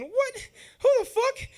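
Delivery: high, startled what; a kid blurting out in shock; shocked disbelief. Retold or imitated. Teen reacting to uncle's fight offer, shocked.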